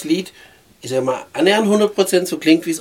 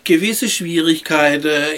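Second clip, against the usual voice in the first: normal talking voice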